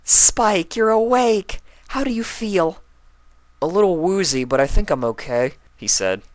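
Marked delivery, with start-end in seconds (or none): 0.0-2.8 high, loud relieved greeting; excited Twilight. Acted. Twilight greeting the waking Spike, high and loud. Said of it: "Twilight rushed into the room". 3.6-5.5 put-on high, loud kid voice, bit dazed; woozy Spike. Spike telling Twilight he feels woozy, high.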